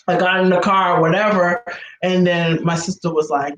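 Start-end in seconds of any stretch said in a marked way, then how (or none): none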